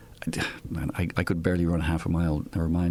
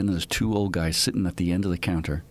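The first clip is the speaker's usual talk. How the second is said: usual speaking voice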